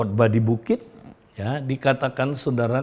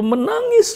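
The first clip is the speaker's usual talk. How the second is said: "menangis" leapt up very high and louder; emphatic weeping; 5 dB louder than usual. Real, not acted. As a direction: lift "menangis" sharply for emotion. Preacher stresses the mother weeping.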